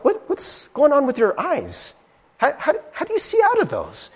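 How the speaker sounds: high, mock-curious schoolkid voice; mocking kids. Kids at school quizzing him about his eyes, mock-curious.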